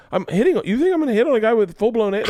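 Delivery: high, fast incredulous retort; mock indignation. Contestant to judge accusing him of flirting, mock-indignant protest.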